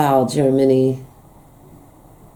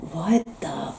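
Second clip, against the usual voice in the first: baffled whisper; 2 semitones higher, 8 dB quieter than usual. Real, not acted. Baffled by own dialect attempt.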